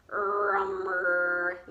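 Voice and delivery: low bear grumble; growl. A narrator's reading. Old Bear growls about the nuts, a low grumble. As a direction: rumble it deep in the throat. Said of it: "growled Old Bear".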